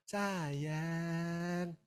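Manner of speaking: slow, mock-tender "I love you"; husband says it without meaning it; a hint of a hollow sweet-talker. The husband tells his wife he loves her, mock-tender and slow. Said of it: "biar ngomong".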